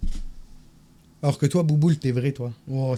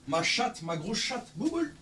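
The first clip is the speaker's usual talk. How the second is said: pet talk; 3 semitones higher, 13 dB quieter, slower than usual. Real, not acted. Cooing to his pet cat.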